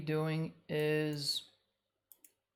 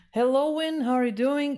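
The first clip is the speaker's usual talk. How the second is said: cheerful greeting; 8 semitones higher, 9 dB louder than usual. Real, not acted. Greeting a chat viewer.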